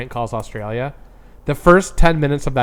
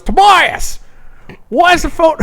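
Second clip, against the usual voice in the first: angry Australian dad; 6 dB louder, slower than usual. Retold or imitated. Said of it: "He goes".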